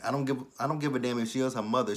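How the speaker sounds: ordinary delivery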